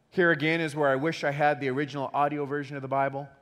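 ordinary talk, nothing marked

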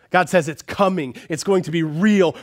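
ordinary talk, nothing marked

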